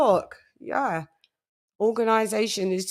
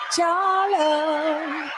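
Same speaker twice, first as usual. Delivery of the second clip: hype shout-out; 6 semitones higher than usual. Real, not acted. Hyped roll call shout.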